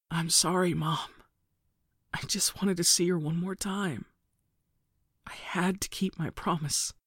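worn-out apology, fading into a whisper. A narrator's reading. Narrator apologizing to mother in hospital bed, hoarse. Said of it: "My voice sounded hoarse".

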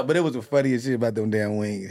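ordinary talk

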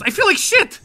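high, pained complaint; miserable vampire groan. Acted. Ivan tells the party he feels awful, high and pained. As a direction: moan it out in misery.

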